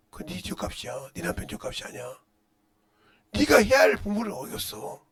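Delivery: ordinary talk, nothing marked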